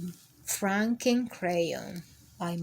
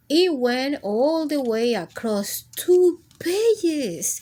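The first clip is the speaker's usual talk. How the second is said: louder, slow, ominous telling; dramatic narration; 4 semitones higher, 6 dB louder, slower than usual. A narrator's reading. Narrator building dread for the children.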